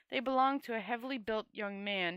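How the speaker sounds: ordinary delivery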